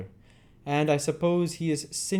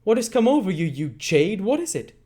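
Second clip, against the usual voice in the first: loud, higher snarling threat; angry threat; 6 semitones higher, 6 dB louder than usual. Acted. Sikes threatening Nancy with the dog, angry and loud. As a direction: threaten her, rising in anger.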